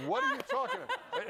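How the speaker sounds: high, laughing through the question; real laughter through the words; laughing disbelief. Real, not acted. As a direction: ask it while cracking up. Quoting himself, laughing.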